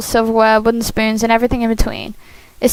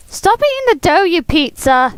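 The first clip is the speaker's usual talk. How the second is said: high shout at him; 6 semitones higher than usual. Acted. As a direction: yell it high and bossy. Shouting at Shane in game.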